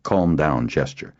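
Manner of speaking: ordinary delivery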